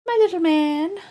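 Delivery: high doting coo to the dog; high pet-talk croon. Real, not acted. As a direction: sing it up to the puppy, rising on little. Cooing to dog, higher.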